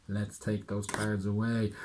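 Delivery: usual speaking voice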